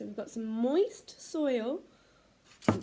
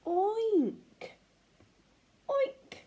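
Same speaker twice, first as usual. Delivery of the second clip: put-on oink like a pig for the children; 9 semitones higher than usual. Shown as a demonstration. Teacher oinking like a pig for the class. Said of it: "Can you say oink like a pig".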